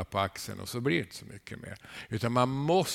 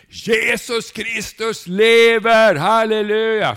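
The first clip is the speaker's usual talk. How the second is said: much higher, much louder, slow yell; ecstatic shout; 11 semitones higher, 13 dB louder, slower than usual. Retold or imitated. Preacher retelling professor shouting from the balcony, ecstatic yell. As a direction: bellow it from the balcony in joy. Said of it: "började han på att skrika på balkongen".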